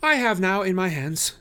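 high, loud, sure of himself; confident. A narrator's reading. Holmes to the detectives, high and confident. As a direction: state it with total certainty. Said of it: "my companion said confidently".

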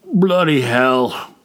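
slow, grumbling grunt. Acted. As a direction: grunt it out wearily. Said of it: "Gullach grunted".

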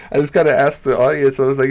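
ordinary talk

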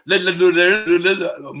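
"Le, le, le" babbled as a garbled RED LORRY; mock tongue-tied babble, "Le, le, le"; imitated tongue-twister failure. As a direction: mimic a tongue tripping into a run of L sounds, "Le, le, le". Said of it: "as quickly as you can".

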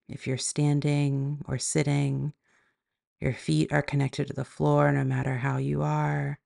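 calm, even meditation voice with long pauses; guided meditation. Acted. Yoga teacher guiding listeners into body and breath, guided meditation. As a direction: guide them gently inward, leaving space between phrases.

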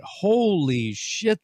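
imagined amazed fan. Acted. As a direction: stretch it out like an astonished listener. Voicing amazed fans' reaction.

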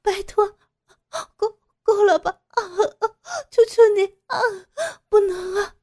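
high, loud sobbing begging; pained pleading. Acted. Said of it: "傅菊英痛苦地哀求". (痛苦地高声哭求)

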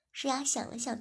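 plain reading voice

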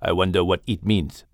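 put-on low, wondering panther with a Malaysian accent; deep big-cat voice, Malaysian accent. Java wondering aloud.

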